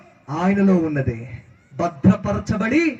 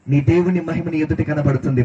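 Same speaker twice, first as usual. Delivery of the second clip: ordinary delivery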